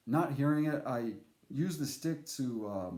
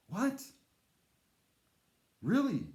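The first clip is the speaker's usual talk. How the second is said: high, sharp disbelief; can't believe it's back; surprised; 9 semitones higher than usual. Real, not acted. Hearing about the snake again, surprised.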